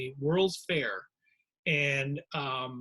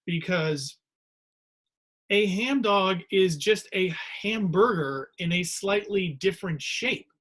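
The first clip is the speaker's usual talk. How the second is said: usual speaking voice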